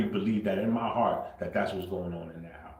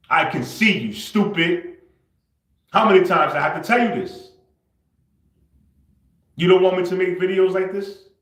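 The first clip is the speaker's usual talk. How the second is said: loud, raised-pitch exasperation; angry scolding; 7 semitones higher, 7 dB louder than usual. Real, not acted. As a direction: tell them off, out of patience. Angry confrontation of absent mother.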